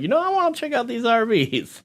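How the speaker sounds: high mocking voice of an eager tourist; mock eager RV fan. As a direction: play the wide-eyed RV fan. Mocking friend's imagined reason.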